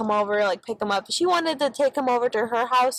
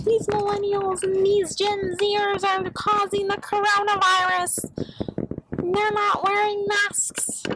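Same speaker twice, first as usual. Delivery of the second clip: slow, higher, preachy mocking old man; 9 semitones higher, slower than usual. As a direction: drag it out like a scolding old man. Mocking old man's complaint.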